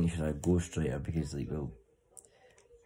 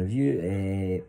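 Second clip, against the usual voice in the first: mid-question stall on eh; hesitant filler; 3 semitones higher, 5 dB louder, much slower than usual. Shown as a demonstration. Advising Scotty on interviewing, a hesitant stalled question. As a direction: stumble into a filler. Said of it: "instead of going".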